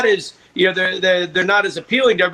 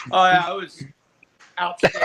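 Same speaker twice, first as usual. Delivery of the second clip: sarcastic praise. Real, not acted. Ironic praise of the song.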